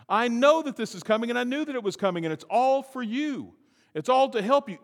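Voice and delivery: high, loud preaching; preaching at full force. Real, not acted. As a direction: drive it home from the pulpit.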